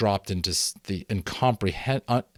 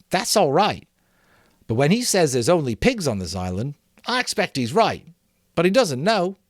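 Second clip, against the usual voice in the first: higher, earnest schoolboy voice; boy Maurice reasoning; 7 semitones higher, 5 dB louder than usual. A narrator's reading. Maurice reasoning with the assembly, higher boyish voice. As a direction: talk it through like an anxious boy.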